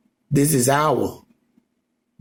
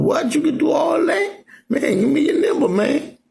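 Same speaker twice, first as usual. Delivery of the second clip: much higher, excited voice of a second guy; amazed friend voice; 14 semitones higher than usual. Acted. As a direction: pitch way up like an impressed buddy hearing big news. Acted street chat, amazed friend.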